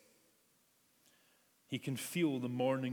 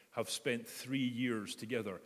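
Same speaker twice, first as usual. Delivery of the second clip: ordinary delivery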